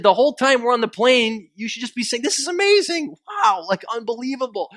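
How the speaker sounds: soaring high on "This is amazing"; mock amazement. Comedian showing passengers how to gush on a plane, hammed-up awe. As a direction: gush with exaggerated wonder.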